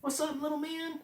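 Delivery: put-on high cooing voice to the inner child; talking to your inner child. Shown as a demonstration. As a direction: greet a small child tenderly. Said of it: "that voice of talking to your inner child".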